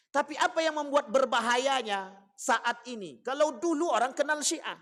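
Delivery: high, loud sermon delivery; forceful preaching. Real, not acted. Preacher warns congregation of present danger, forceful preaching. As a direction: press the warning on the congregation, loudly.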